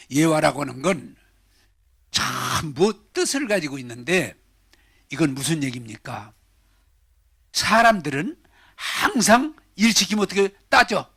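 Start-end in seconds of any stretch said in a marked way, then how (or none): none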